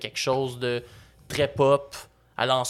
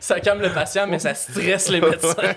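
laughing; 5 semitones higher than usual. Real, not acted. Joke while laughing.

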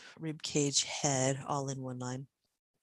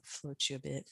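guided meditation. Acted. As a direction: settle them into rest gently. Guides Shavasana relaxation.

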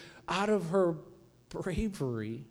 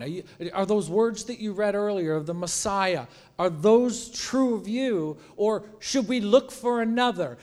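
pleading disciples; 3 semitones higher, 7 dB louder than usual. Retold or imitated. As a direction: beg him for an answer.

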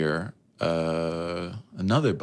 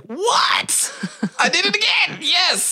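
very high, squealing mock elation; ecstatic disbelief; slower than usual. Retelling being offered paid nights, squealing delight. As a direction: squeal it like a triumphant winner. Acted joy at getting paid job.

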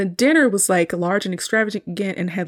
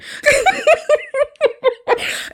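very high, louder laugh; real laughter; 4 dB louder than usual. Real, not acted. Calling the scene funny, very high burst of laughter. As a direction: crack up fully.

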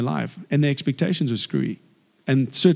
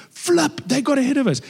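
high-pitched exclamation, acting the upset disciples; mock indignant disciples; 10 semitones higher than usual. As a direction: blurt it out high, imitating miffed followers.

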